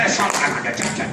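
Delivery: low, slushy s, Scottish film star; Sean Connery. Retold or imitated. Repeating the film star's Egyptian claim, deep Scottish impression. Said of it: "And I said".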